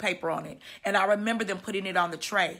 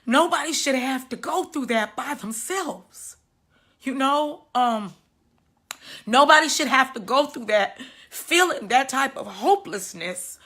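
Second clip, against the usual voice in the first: emotional; 4 semitones higher, 4 dB louder than usual. Real, not acted. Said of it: "I get a little emotional".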